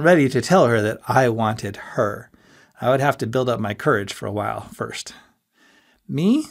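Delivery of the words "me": feigned innocence, high and light; innocent. A narrator's reading. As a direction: play dumb, all innocence. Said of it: "I answered innocently".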